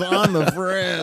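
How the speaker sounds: slow, dry comedian's delivery; deadpan it like a stand-up; Paula Poundstone. Retold or imitated. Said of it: "like Paula Poundstone's".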